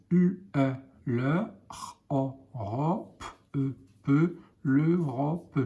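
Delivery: put-on slow letter-by-letter blending for a child; sounding out letter by letter. Shown as a demonstration. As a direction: sound out each letter of sœur, then blend into sœur. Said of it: "teacher sounds out letters".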